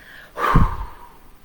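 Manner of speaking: big loud exhale of relief; acted heavy sigh of relief; relieved sigh. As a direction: let it all out in one long breath. Acting out anxiety washing away.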